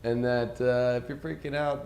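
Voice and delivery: ordinary talk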